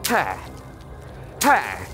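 normal talking voice

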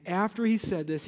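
usual speaking voice

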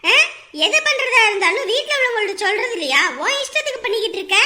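loud, indignant dressing-down; angry scolding. Acted. Woman scolds him for acting without telling family, angrily. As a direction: scold him with forceful questions.

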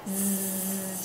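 a long voiced zzz buzz; buzzing z sound. Shown as a demonstration. Teacher demonstrates the voiced zzz buzz. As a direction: hold a buzzing z. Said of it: "chuẩn bị ra chữ du".

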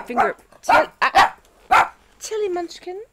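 high startled cries; startled yelp. Real, not acted. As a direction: yelp at the dog. Dog grabbing her finger.

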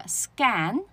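scan, stretched, no puff; unaspirated k. Shown as a demonstration. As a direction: scan slowly as "sgan". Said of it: "再念一下啊".

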